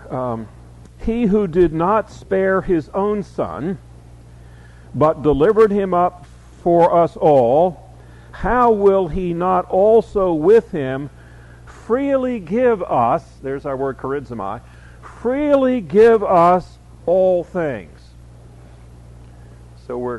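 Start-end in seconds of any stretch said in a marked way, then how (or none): 1.0-13.4 slow, raised, weighty Bible reading; emphatic scripture reading. A narrator's reading. Reads the scripture verse aloud emphatically. 15.0-17.9 slow, raised, weighty Bible reading; emphatic scripture reading. A narrator's reading. Resumes the emphatic verse reading.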